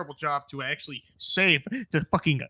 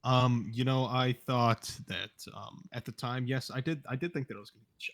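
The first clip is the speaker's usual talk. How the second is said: quiet; 5 semitones lower, 7 dB quieter than usual. Real, not acted. Nervous admission under questioning.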